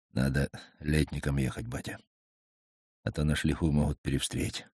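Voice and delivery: half voice. A narrator's reading. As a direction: keep it to half voice, cautious. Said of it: "полголоса сказал Игнат".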